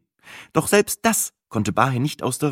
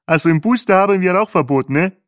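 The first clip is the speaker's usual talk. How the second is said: high, loud character voice; Bahe, firm tour driver; 10 semitones higher, 6 dB louder than usual. A narrator's reading. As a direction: lay down the rule, pitched up. Guide answers the passenger.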